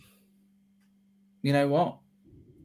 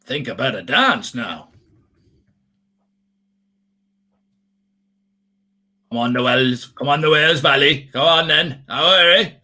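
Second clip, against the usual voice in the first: hammed-up Welsh cheerleader accent. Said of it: "Your Welsh accent and [name] impression".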